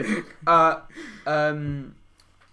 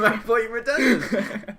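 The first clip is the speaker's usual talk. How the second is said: high, loud, slow words through laughter; real giggling through the line; laughing while talking; 10 semitones higher, 10 dB louder than usual. Real, not acted. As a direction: get the words out while cracking up.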